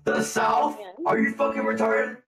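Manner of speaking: loud, high outraged questions; incredulous shout. Real, not acted. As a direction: yell it in disbelief. Scorns a wrong guess about accent.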